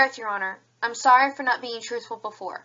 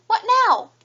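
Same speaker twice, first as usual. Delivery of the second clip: higher, louder alarmed question; startled; 10 semitones higher, 5 dB louder than usual. Acted. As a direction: caught off guard again. Witness hit by contradiction.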